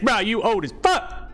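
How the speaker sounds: normal talking voice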